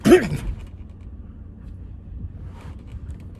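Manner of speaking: a breathy mock throat-clear; imitated throat clearing. Heard down the road, a mock throat-clear. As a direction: fake a throat-clearing noise from far off. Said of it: "we hear this".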